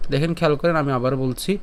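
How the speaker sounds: usual speaking voice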